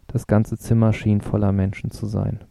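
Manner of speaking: ordinary delivery, nothing marked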